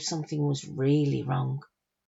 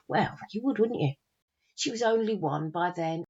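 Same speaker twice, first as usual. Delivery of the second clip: usual speaking voice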